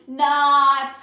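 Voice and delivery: loud, drawn-out punchline; emphatic joking negation. Real, not acted. Streamer joking that people think she spends money, loud negation. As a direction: land the "not" joke big and long.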